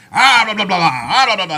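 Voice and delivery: loud, high hyped-up announcer; crazy announcer yell. Retold or imitated. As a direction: ham up a wild hype man. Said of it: "You have guys that go crazy".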